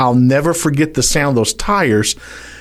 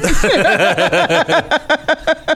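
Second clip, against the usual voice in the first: laughter; 13 semitones higher than usual. Real, not acted. Laughing at own story.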